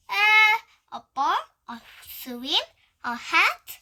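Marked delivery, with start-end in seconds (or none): none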